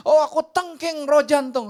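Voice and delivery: loud, high hallelujah shout; hammed-up rebuking prayer, full volume; mock charismatic shout. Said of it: "orang Kristen akan bilang".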